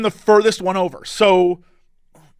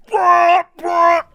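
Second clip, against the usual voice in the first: impatient dude; 8 semitones higher than usual. Retold or imitated. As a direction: imitate an impatient guy calling out. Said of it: "the dude behind me is like".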